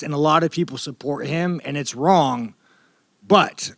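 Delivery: ordinary talk, nothing marked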